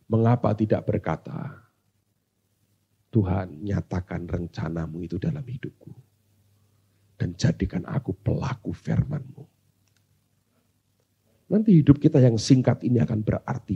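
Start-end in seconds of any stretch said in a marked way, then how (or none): 3.1-9.4 low, soft plea to God; murmured personal prayer; humble prayer. Acted. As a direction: pray it low and hushed, with reverence.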